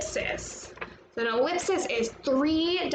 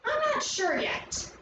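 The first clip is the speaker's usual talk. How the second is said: much higher, playful unsure tone; unsure face voice; 10 semitones higher than usual. Pretend. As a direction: sound like you really don't know. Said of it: "make a face of".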